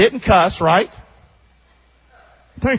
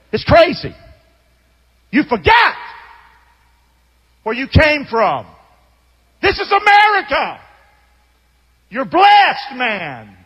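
loud, higher, incredulous scolding; heated rebuke; 3 semitones higher, 3 dB louder than usual. Retold or imitated. Preacher replays telling off the griping retiree, loud and heated. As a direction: let him have it, fired up. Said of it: "I didn't talk like that, though".

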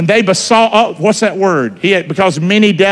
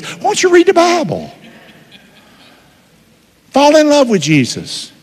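high-pitched exhorting question; exasperated plea; 9 semitones higher than usual. Real, not acted. Preacher chiding Netflix watchers, exasperated high plea. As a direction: chide them in a high, pleading pitch.

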